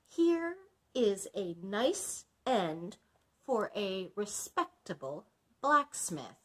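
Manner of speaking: slow, deliberate words, each one clear; very distinctly. Acted. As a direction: say it again slowly and carefully for the dragon. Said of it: "very distinctly".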